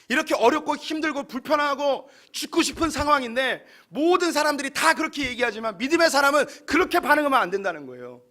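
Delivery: ordinary talk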